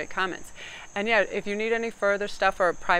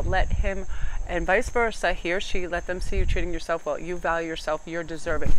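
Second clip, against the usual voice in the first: usual speaking voice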